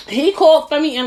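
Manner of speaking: ordinary delivery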